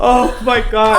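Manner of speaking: shocked. Real, not acted. Both shocked by sidewalk story.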